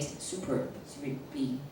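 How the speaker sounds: soft whispered praise. Shown as a demonstration. Teacher demonstrating praise words, whispered. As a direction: breathe the praise out softly. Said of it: "demonstrating praise phrases".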